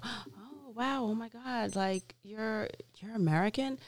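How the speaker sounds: mock amazement. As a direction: imitate amazed onlookers, soft and high. Host imitates amazed schoolmates.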